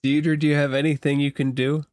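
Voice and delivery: ordinary talk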